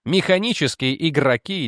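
ordinary delivery

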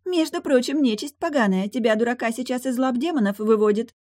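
high, loud mermaid retort; indignant mermaid. Acted. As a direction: answer the insult in a high pitch.

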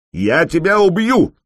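high, loud battle threat; yelled from afar. Acted. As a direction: bellow it across the field. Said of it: "издали заорал противник". (орёт издалека)

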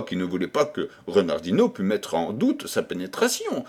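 plain reading voice

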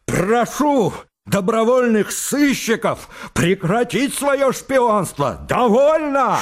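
high, strained cry near hysteria; high overwrought shout; shouting in agitation. Acted. Laevsky continues tirade.

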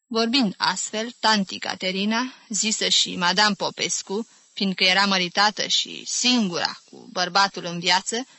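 plain reading voice

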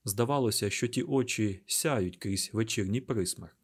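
usual speaking voice

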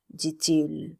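usual speaking voice

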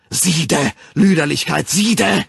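loud, high furious cry; raging shout. A narrator's reading. The Savage swinging his whip, raging shout. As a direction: rage it out with each blow.

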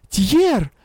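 high, alarmed call; frightened exclamation. A narrator's reading. Witch calling out to Tier, frightened exclamation. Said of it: "испуганно воскликнула благодать Никаноровна".

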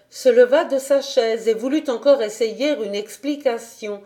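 ordinary delivery, nothing marked

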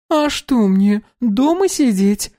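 put-on high, slow surprised girl voice. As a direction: ask it as if amazed. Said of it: "удивилась Доминика".

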